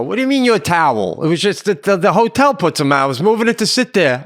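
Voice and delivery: yelling. Retold or imitated. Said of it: "I'm yelling to him now".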